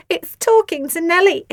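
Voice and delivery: mocking snigger. A narrator's reading. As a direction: Asti sneering at Phoebe. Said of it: "sniggered Asti".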